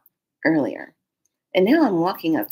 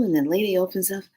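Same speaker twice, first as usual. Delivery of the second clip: ordinary delivery, nothing marked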